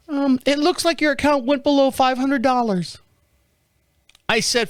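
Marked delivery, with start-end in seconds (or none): 0.1-3.0 put-on high, girlish rep voice; little girl on phone. Bank rep asking caller to verify, high little-girl voice. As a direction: rattle off verification in a girlish pitch. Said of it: "I get this little girl on the phone".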